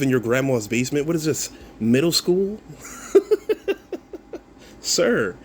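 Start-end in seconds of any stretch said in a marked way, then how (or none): 2.6-4.4 shrieking disbelieving laugh; a real laugh at a silly insult; high burst of laughter. Real, not acted. Laughing at the comment.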